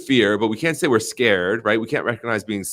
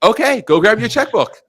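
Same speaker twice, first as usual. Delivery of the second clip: excited salesman; 11 semitones higher, 5 dB louder than usual. Retold or imitated. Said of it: "I'm like".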